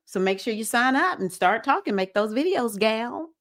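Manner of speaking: put-on playful, folksy Southern accent. Spirit-realm friend telling her to make YouTube videos, playful Southern voice. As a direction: urge her along with a little Southern twang. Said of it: "I'm using my little Southern voice right now".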